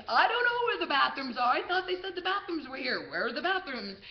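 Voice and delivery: high, loud put-on cluelessness. Lost-tourist act for approaching security, very loud. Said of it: "I just start saying very loudly in English".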